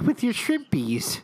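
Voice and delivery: put-on high, slow cutesy baby voice; mock baby talk. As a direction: coo it like talking to a toddler. Teasing the cutesy menu name.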